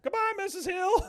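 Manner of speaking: put-on very high, bright kid's goodbye. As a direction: chirp it like a kid. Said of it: "from Mike Soto".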